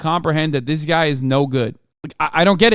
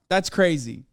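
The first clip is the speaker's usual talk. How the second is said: usual speaking voice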